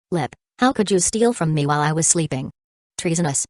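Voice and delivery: ordinary delivery, nothing marked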